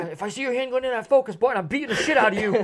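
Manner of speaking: high, loud, fast comic threat; blustering pretend rage; mock threatening yell. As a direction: shout a warning, hammed-up. Bigfoot threatens the photographer, acted.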